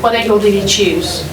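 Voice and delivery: ordinary talk, nothing marked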